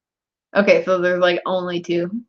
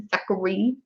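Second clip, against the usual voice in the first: ordinary delivery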